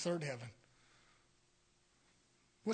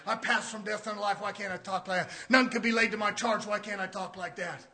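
ordinary delivery

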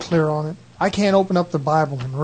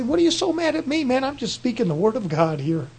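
higher, pleading, puzzled; mock bewildered plea; 7 semitones higher, 3 dB quieter than usual. Preacher to angry hearer, pitched up in hurt surprise. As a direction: ask it up high, hurt and bewildered. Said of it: "that's what I say".

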